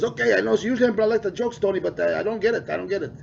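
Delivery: a mock teacher who doesn't get it; baffled teacher. Teacher voiced, confused.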